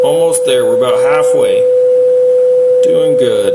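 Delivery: firm, loud encouragement to keep going; loud coaching. Real, not acted. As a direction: push them to blow longer, loud. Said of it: "coaching a breath test".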